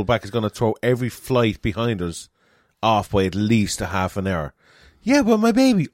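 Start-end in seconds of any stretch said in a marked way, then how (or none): 5.0-5.9 mock pleading mum. Voicing the panicked mum.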